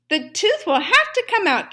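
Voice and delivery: high, loud, firm announcement. A narrator's reading. Dentist announcing the verdict to the fox. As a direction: declare it clearly. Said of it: "Doctor DeSoto announced".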